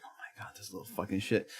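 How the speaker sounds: whispered start into low, quiet mutter; muttered aside. Retold or imitated. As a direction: grumble it secretly under your breath. Private reaction in grocery store.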